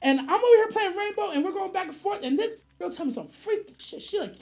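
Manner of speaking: ordinary talk, nothing marked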